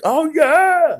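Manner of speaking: high hyped-up cheer; crowd cheering. Retold or imitated. Onlookers hyping up the kid, loud high cheer.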